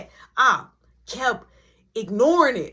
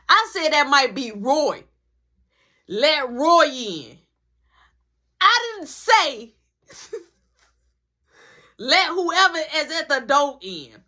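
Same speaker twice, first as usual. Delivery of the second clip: exasperated scolding; 4 semitones higher, 5 dB louder than usual. Retold or imitated. Teller scolds Marky for letting the girl inside, exasperated. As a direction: stretch the words out in exasperation.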